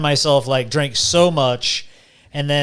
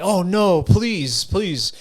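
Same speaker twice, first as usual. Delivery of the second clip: higher, acted begging; mock pleading; 5 semitones higher, much slower than usual. Drinker begging his liver for his life, mock pleading. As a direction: plead for mercy, each please its own appeal.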